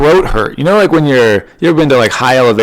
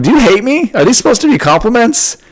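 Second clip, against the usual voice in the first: much higher, outraged mock whine; mock indignant squeak; 13 semitones higher, 3 dB louder than usual. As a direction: squeal it, hurt and incredulous. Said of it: "And I'm like".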